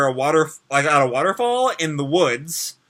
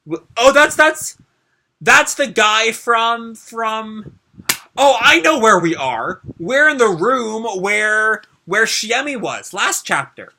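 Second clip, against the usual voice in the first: excited realization; 6 semitones higher, 4 dB louder, slower than usual. Real, not acted. Reader suddenly recognizes the scene.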